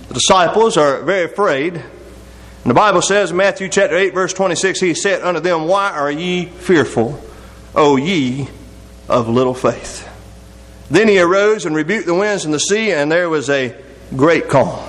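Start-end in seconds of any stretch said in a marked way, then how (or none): 5.7-10.1 low, slow scripture quote, broken by pauses; slow solemn rebuke. Retold or imitated. Jesus to the frightened disciples, slow solemn rebuke. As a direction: sink lower phrase by phrase, taking it slowly.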